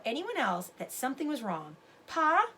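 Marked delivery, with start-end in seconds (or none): 2.1-2.5 loud call to Pa, higher; boy calling his father for help. Acted. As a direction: call across the camp to Pa. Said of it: "Sam called".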